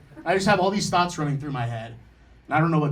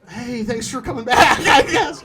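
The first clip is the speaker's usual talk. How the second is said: high, loud, laughing mock thanks; sarcastic, cracking up; 7 semitones higher, 6 dB louder, slower than usual. Sarcastic quote to mom.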